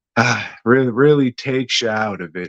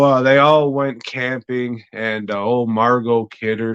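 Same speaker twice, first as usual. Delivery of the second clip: usual speaking voice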